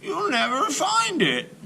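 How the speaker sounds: high, cautioning granny voice; a grandmother's high warning. A narrator's reading. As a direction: warn him like a fond grandmother. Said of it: "she warned".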